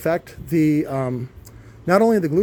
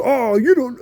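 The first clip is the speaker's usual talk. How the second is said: higher and louder put-on whiny objection; mock customer protest; 6 semitones higher, 4 dB louder than usual. Imitating customers who argue about burning subwoofers, mock whine. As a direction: squeak out a customer's defensive reply. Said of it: "And they go".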